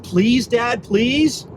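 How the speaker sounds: high begging plea; begging please. Retold or imitated. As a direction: whine it like a pleading child. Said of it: "he thought".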